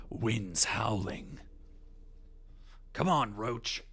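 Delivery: slow, low Geralt calling his horse; Geralt impression. Recalling the Witcher, he calls his horse as Geralt.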